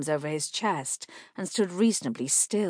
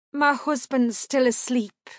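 woman's voice; 7 semitones higher, 5 dB louder than usual. A narrator's reading.

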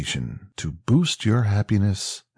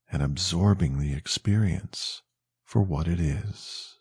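guided meditation; 2 semitones lower than usual. Acted. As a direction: ease the listener toward sleep, gently.

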